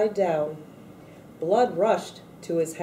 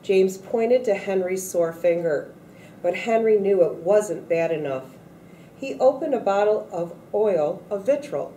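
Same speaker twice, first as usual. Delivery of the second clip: usual speaking voice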